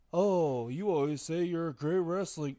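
mocking imitation. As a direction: parrot him back in a silly voice. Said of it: "You're sitting here saying".

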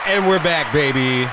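mock host. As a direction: announce the return like a radio host. Mock show host return.

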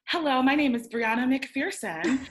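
imitating a girl introducing herself in class; quoted classmate. Classmate introducing herself to the class, mock formal voice.

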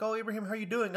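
high, sweet imitation of the counselor. Old counselor greeting Ibrahim, high imitation. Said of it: "she's like".

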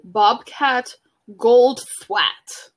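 Bobcat Goldthwait read out very slowly; slow careful spelling-out. Shown as a demonstration. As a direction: sound out Bobcat Goldthwait syllable by syllable. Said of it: "this can't be right".

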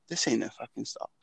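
low, hushed aside to oneself; thought to self. Retold or imitated. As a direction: drop it right down like a private thought. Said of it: "I think to myself".

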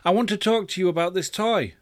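high-pitched, mildly impatient parent; slightly irritated. A narrator's reading. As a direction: raise the pitch with a touch of irritation. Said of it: "getting just a little irritated".

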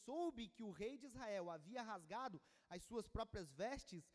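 ordinary delivery

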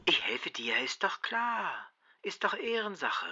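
soft, reassuring promise; comforting. Acted. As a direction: reassure the sick friend gently. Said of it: "tröstete ihn Herbert".

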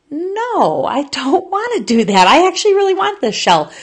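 put-on high, pleading childish whine; whiny protest. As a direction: beg to keep the taco shell like a sulky kid. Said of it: "And I was like".

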